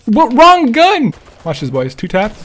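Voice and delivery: usual speaking voice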